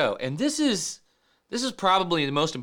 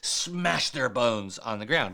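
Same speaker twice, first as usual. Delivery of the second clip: hushed, breathy fury at his enemies; David's angry plea; 3 semitones lower, slower than usual. Retold or imitated. David begging God to punish his enemies, in a hushed furious whisper. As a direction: seethe it out under the breath. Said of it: "He's like".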